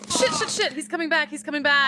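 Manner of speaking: high-pitched panic, fast and tight; panicked. Acted. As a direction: alarm rising as he returns. Panicked warning, man returning.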